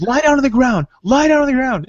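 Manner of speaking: high, loud commanding cop voice, said twice; mock police order. Friend ordering a new player down, high loud mock cop order.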